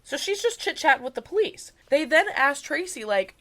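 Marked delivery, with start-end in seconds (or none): none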